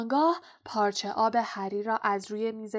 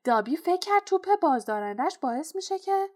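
high, loud elf voice; high-pitched servant creature; house-elf Dobby; 9 semitones higher, 5 dB louder than usual. A narrator's reading. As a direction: plead like an excitable little elf.

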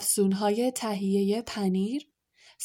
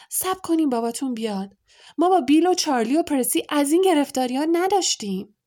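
higher, louder furious mother scolding; angry scolding; 5 semitones higher, 5 dB louder than usual. A narrator's reading. Mother shouting at her sons who took the car, furious. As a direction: tear into the boys, rising to a shout. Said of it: "صدای خشمگین مادرشان".

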